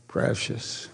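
Precious whispered like Gollum; a whispered Gollum callback; Gollum echo. Retold or imitated. Pastor echoing the hobbit impression, hushed whisper. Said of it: "what is that piano? Precious".

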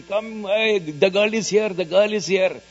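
high, loud re-enacted alarm; urgent retold shout. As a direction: call out loudly for people to come. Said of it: "I started shouting".

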